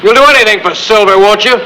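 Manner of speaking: high, mocking questions; bitter taunting. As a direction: shame them with scorn. Taunting the silent crowd.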